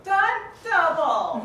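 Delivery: loud drawn-out call. Acted. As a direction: shout it out to summon help. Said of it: "I have the freedom to call stunt double".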